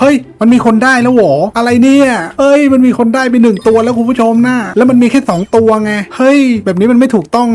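high, loud startled exclaiming; shocked outburst. Real, not acted. Streamer shocked another player caught the whale, high loud outburst. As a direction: blurt it out in real shock, voice jumping up.

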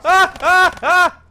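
short, high, loud yelps in a row; panicked screams. Real, not acted. Player under attack in the game, panicked screams. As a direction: yelp over and over in alarm.

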